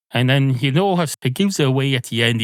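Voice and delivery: usual speaking voice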